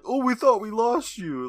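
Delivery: mocking quote. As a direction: parrot the line in mild disbelief. Mocking the parents' line.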